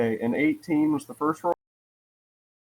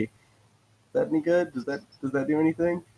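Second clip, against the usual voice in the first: usual speaking voice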